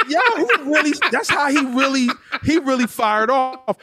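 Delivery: high-pitched, laughing re-enactment; amused amazement. Retold or imitated. Recalling friend cursing around parents, amused amazement. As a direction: marvel at it through laughter.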